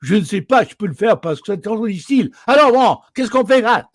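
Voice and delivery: high, loud, rapid-fire Parisian French; very rapid delivery. Acted. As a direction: rattle off French slang with swallowed words. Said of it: "and delivered very rapidly".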